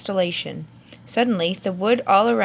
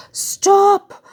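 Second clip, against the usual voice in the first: high, loud desperate shout; yelled; 12 semitones higher, 8 dB louder than usual. A narrator's reading. Mr Weasley stopping the wizards attacking his son, loud yell. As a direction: scream it to halt the spells. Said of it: "yelled a voice he recognized".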